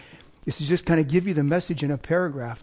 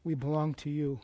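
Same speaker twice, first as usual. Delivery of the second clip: soft, quiet earnest prayer to the Lord; 12 dB quieter than usual. Acted. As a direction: pray it softly, head bowed. Opening prayer before the sermon.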